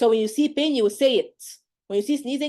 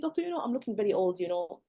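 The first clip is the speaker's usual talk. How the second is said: mild patient lament; 3 semitones lower, 6 dB quieter than usual. Retold or imitated. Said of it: "she'll tell you".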